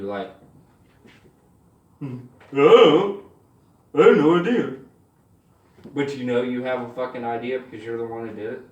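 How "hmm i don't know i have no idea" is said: loud, goofy imitation of a boy playing dumb; clueless boy. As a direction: play dumb in a put-on voice. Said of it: "but all the boys do".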